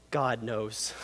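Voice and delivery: hushed dread. Retold or imitated. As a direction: let it sink in like a scared kid. Frightened child after stealing.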